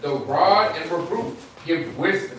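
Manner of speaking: booming Bible verse read aloud; loud scripture reading. Real, not acted. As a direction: read it out like from a pulpit.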